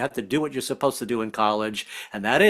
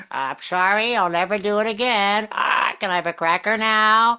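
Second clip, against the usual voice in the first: put-on childish voice; 6 semitones higher than usual. Kid voice bit, far and high.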